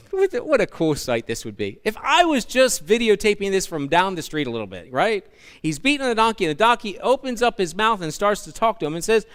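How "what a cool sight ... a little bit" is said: normal talking voice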